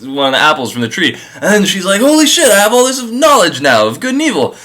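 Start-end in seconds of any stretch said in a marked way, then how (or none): 2.0-4.5 higher, wide-eyed wonder; sudden revelation, higher pitch; amazed. Retold or imitated. Eve after eating the apple, amazed and higher.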